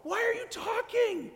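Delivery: high, loud mock outrage at being interrupted; outraged outburst. Preacher as herself, outraged her partner talks over the song. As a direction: yelp it like your song was ruined. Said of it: "you're like".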